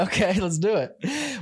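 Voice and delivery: high, slow, hammed-up let's do it; mock eager. Agent jokingly agreeing to sign a client, mock eager. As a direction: play up the eagerness. Excited reply to a found client.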